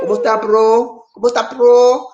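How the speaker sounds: cheerful shout-out; hearty greeting. Real, not acted. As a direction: call out a greeting. Greeting in Tagalog.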